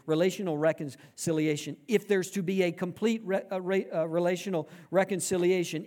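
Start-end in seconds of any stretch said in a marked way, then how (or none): none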